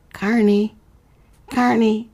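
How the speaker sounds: high distant calling of her name. Acted. The man calling Carney across the carnival. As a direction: call her from far away.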